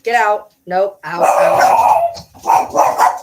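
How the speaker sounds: loud, short commands; ordering dogs out. Real, not acted. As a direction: shout the dogs out of the room.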